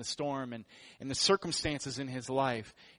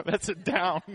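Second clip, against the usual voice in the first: ordinary talk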